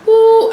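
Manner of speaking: high, loud mock gasp ooh; mock gossip ooh. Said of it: "on the news just goes".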